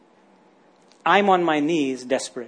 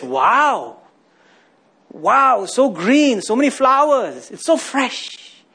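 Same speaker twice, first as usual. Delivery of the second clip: higher, gushing over the flowers; mock admiring; 4 semitones higher, much slower than usual. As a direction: gush over the surface, tongue in cheek. Mocking admiration of a cemetery.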